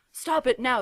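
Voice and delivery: teacher shouting at misbehaving students; shouted order. A narrator's reading. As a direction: bark the order to stop. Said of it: "shouted Professor McGoogle".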